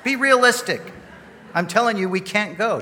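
high, loud acted-out arguing; exasperated protest. Husband telling his wife she can't go alone, loud exasperated re-enactment.

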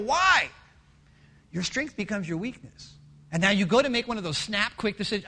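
usual speaking voice

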